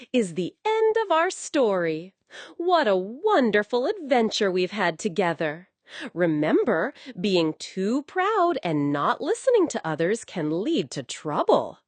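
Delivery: usual speaking voice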